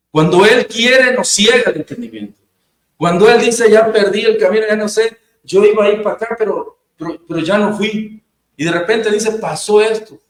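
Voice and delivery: fervent preaching. Acted. Pastor urging the congregation, fervent preaching. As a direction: drive the point home to the congregation.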